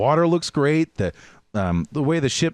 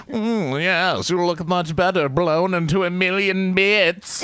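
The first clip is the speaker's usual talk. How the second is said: higher, hammed-up proper British voice; hammy posh British accent; 9 semitones higher, 3 dB louder, slower than usual. Quoting the game's navy voice lines to a friend, posh British ham. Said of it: "the proper British accent".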